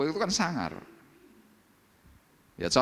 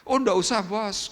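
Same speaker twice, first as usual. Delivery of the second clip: eager refusal; 11 semitones higher, 5 dB louder than usual. Acted. Acted reply refusing payment.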